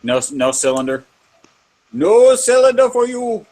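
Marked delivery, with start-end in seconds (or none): 1.9-3.5 higher, louder mock refusal; comic shout. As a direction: bark it like a stern shopkeeper. Comic shouted refusal, movie parody.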